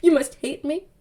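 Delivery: mock-tearful, halting apology with long pauses; milk every pause like a tortured melodrama hero; hammed-up dramatic. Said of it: "he's all dramatic".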